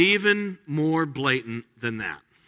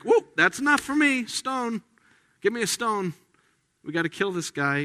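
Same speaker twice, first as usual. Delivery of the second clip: angry mob; 8 semitones higher than usual. Retold or imitated. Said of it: "And they're like".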